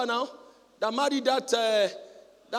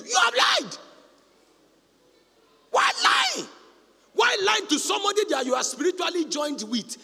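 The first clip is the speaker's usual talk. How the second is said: high, loud rebuke of lying; rebuking shout; 10 semitones higher, 5 dB louder than usual. Acted. Preacher rebuking wives who lie, high shout. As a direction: cry it out to the congregation.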